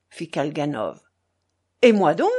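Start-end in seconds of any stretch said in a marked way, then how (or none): 1.8-2.3 louder, emphatic chiming in; eager, pushed-out “Et moi donc”; insistent. A narrator's reading. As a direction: press to be included. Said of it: "appuya Maximov".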